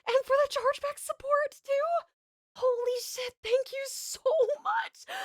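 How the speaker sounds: high, delighted thanks breaking into a laugh; squealing with real delight; overjoyed thanks. Real, not acted. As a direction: gush your thanks, stunned by the gift. Overwhelmed by a big donation.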